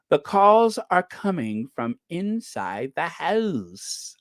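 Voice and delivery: slow, creepy upper-class drawl on "calls"; mock the smug posh journalist, slow and sinister; mimicking a pompous posh pundit, slow and creepy. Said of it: "in his creepy, very posh voice".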